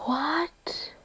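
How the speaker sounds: unvoiced awed whisper; whispered disbelief. Real, not acted. To viewers at the unboxing, whispered. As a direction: barely breathe it.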